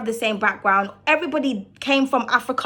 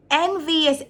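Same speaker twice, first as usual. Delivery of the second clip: usual speaking voice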